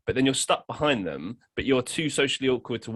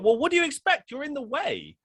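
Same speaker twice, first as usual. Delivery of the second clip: annoyed stranger. Retold or imitated. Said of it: "and it's like".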